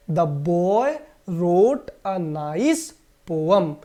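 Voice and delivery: very slow, word by word; slow model sentence. Shown as a demonstration. Teacher reads the example sentence to the class very slowly. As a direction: dictate it slowly. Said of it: "क्या बोलेंगे?".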